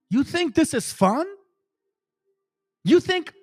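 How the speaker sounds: indignant rant. Real, not acted. Pushing back at critics who call him hurtful, indignant.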